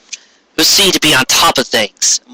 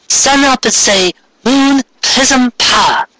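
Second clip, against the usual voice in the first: high, slow cat voice; Luna instructing; 11 semitones higher, slower than usual. Acted. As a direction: spell out the magic words slowly like a coaching cat.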